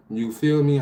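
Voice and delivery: normal talking voice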